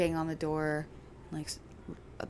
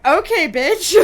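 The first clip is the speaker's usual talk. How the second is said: sassy mock voice; 10 semitones higher, 16 dB louder, slower than usual. Mocking cats' indifference.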